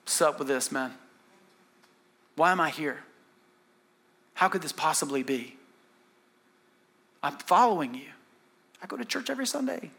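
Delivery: hushed, wounded appeal to God; soft acted lament from prison; quiet questioning. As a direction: ask it softly, as a private bewildered prayer. Acting Joseph's lonely questioning prayer.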